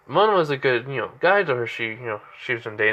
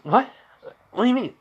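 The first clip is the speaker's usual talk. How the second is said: higher-pitched, taken aback; mild startled question; 11 semitones higher, faster than usual. Retold or imitated. Girlfriend to boyfriend who noticed her tears, startled. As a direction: voice the caught-out girlfriend, pitch jumping up.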